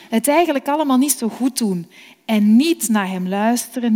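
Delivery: ordinary delivery, nothing marked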